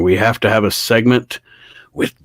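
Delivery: mock boss impression, slow and emphatic; hammed-up, slow insistence; Vince. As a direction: drag out the key words like a pompous boss. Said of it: "Vince sitting down with Drew McIntyre going".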